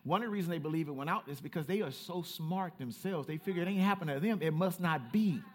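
ordinary delivery

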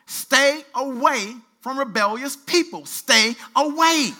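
slow, loud, drawn-out warning; slow emphatic warning. Real, not acted. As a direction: stretch every word to drive it home. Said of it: "drawn-out warning".

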